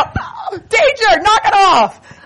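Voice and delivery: high, loud laughing call of danger, the last words slow; retold alarm at a slouching man, laughed and shouted; playful warning shout. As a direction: bark a joking alarm at him.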